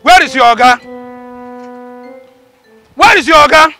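high, very loud demand; angry shouting. Acted. Customer storming in looking for the boss, angry shouting. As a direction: yell the question twice, furious.

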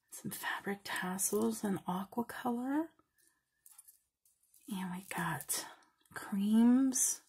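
hushed, close to the mic; soft whispered aside; whispered listing. Real, not acted. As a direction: whisper the list of beads. Listing bead contents softly.

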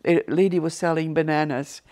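normal talking voice

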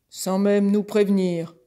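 loud, affronted bull voice; indignant bull. Acted. Bull adding his complaint to the horse, loud indignant voice. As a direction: boom it out in outrage.

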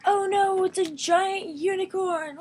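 mock terror. Pretend animal game, hammed-up frightened cry. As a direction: shriek like a toy in danger.

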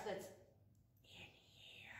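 slow, secretive whisper; hushed aside to the camera. Acted. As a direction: whisper it like a confession.

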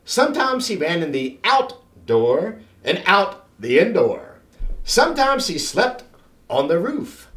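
plain reading voice

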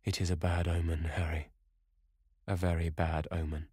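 subdued, understated gloom; heavy sigh. A narrator's reading. Dorian confiding dread to Lord Henry, with a heavy sigh. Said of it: "said with a heavy sigh".

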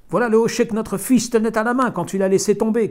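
loud, high call from a distance. A narrator's reading. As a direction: call across the woods. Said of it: "cria le fermier d'entre les arbres".

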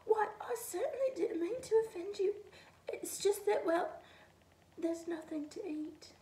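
high, hushed timid rabbit. A narrator's reading. Rabbit explains to the snowman, high and timid. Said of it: "squeaked the rabbit".

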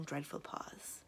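plain reading voice

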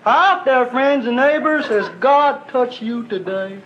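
high, slow crooning radio evangelist; radio preacher. Retold or imitated. Hillbilly radio host greets Sunday listeners, high and slow. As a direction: sell God sweetly and slowly over the air.

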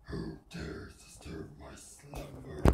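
very low, slow rumbling cave voice; booming from deep inside a stone cave; grinding stone statue. Acted. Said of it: "Cave of Wonders".

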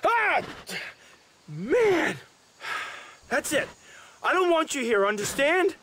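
high loud angry yells; angry shouting. Acted. Shouting at an animal to leave him alone, high and loud. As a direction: drive it away, furious.

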